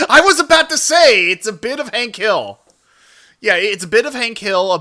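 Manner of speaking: loud, high outburst; excited agreement. Real, not acted. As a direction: jump in loudly, thrilled at the match. Bursts in agreeing with viewer's comparison.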